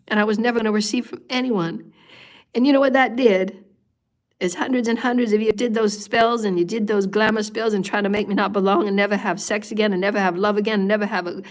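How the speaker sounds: ordinary delivery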